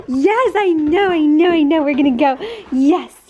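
I know, I know, calm them while grinning; excited pet talk. Real, not acted. Answering her excited dogs.

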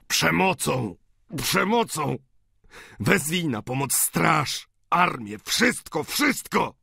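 high, forceful outcry; wołanie. A narrator's reading. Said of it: "Zawołał, rzucając na Eugeniusza ostatnie spojrzenie". (gwałtowny, rozpaczliwy okrzyk)

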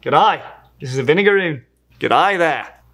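hearty mock-Australian greeting; Australian accent. Host asked to try Australian for the intro, Australian accent.

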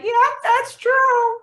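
high, slow and loud imitation of her mother; loud Jamaican mom. Daughter mimics her mom confirming she was shy, in a loud Jamaican-mom voice. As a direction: boom it out like a proud Jamaican mom. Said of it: "who's a loud Jamaican woman".

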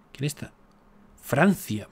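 ordinary delivery

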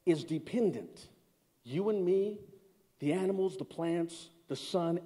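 slow, quiet, every phrase weighed; slowly. Shown as a demonstration. Preacher repeating his question slowly to the congregation. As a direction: say it again slowly so they get it. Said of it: "Let me say it slowly so that you can get this".

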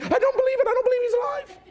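high, put-on voice of scared men; mock the big tough boys' disbelief; doubting disciples. Preacher mimics the hiding disciples doubting Jesus lives, in a high mocking voice.

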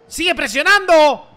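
slow, loud command; loud urging. Real, not acted. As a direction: push them on loudly. Urging on the game.

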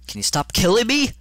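high, loud outburst; exasperated shout. Real, not acted. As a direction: yell at the game in frustration. Snaps at the game.

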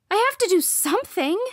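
high, loud, urgent protest; desperate insistence. A narrator's reading. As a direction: plead to be let into the fight. Desperate to join the fight.

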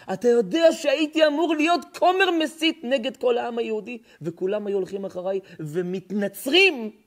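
high, loud pleading cry; urgent preaching. Acted. Son urging his grieving father to thank God. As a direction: plead it out at full force. Son's urgent plea in sermon.

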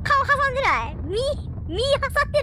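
alarmed squeal. Real, not acted. Player checks if the character's face got squashed, alarmed squeal. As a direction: squeak out fake concern.

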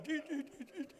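high, tiny, fading chant; mimicked fleeing prayer. Preacher imitates women running away, faint high fading prayer. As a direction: imitate frightened ladies receding into the distance. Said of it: "they're running away".

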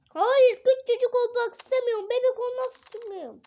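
high, loud pleading no; panicked protest. Acted. Shrinking muscle man pleading against becoming tiny, panicked and high. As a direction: beg not to shrink, voice way up.

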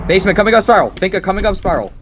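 high, loud urgent callout. Real, not acted. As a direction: call out the enemy fast.

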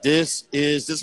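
stiff, word-by-word robotic reading. Retold or imitated. Little kid reading to his big brother, robotic and stiff. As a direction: sound out each word like a robot. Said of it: "this is why I'm talking like a robot".

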